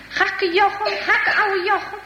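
taunting call. Acted. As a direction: tease him like boys calling in the street. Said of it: "En de jongens roepen". (plagend geroep, hoog en gerekt)

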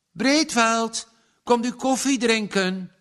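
high, loud call through the door. A narrator's reading. Young woman calls her employer to coffee, loud. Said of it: "riep Lientje".

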